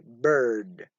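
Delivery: "bird" low, with an r-coloured vowel; teacher's model "bird", er sounded clearly; r-coloured schwa. Shown as a demonstration. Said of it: "followed by er".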